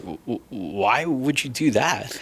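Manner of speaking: slow, stammering, hushed disbelief; a hint of a shocked executive; stunned stammer. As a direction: stumble over it like he can't believe you. Exec stunned on the phone.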